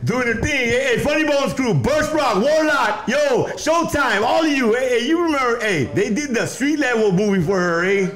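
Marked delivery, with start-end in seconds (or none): 0.0-5.6 high, hyped shout-outs to the crews; excited shout-outs. Real, not acted. Shouting out old crews to the camera, excited. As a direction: call out every crew name, pumped up.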